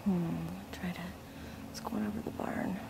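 hushed whisper to the camera. Real, not acted. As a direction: whisper so as not to be heard. Filming quietly, hushed.

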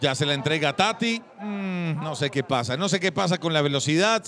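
ordinary talk, nothing marked

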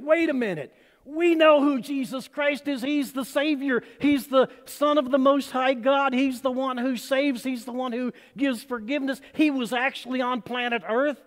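excited aliens. Retold or imitated. Preacher voicing aliens learning who Jesus is, excited. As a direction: gush like an alien who just found the answer. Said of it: "And the aliens got all excited".

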